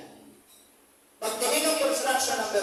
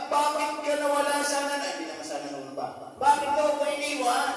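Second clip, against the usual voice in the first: mock crying wife; 7 semitones higher, 4 dB louder than usual.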